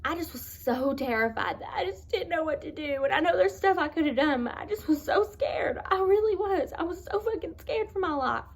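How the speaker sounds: fighting back real sobs while telling it; voice breaking. Real, not acted. Breaks down recalling terror.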